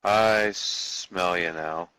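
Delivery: very slow menacing creature line. Acted. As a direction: drag it out like a hunting beast. Player voices menacing creature.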